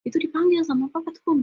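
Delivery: high, pushy call to come downstairs; sibling telling her papa wants her downstairs, high and pushy; impatient summons. Retold or imitated. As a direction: hurry her down, mock-bossy. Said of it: "kata dia gitu".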